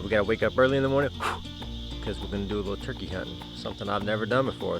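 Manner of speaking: usual speaking voice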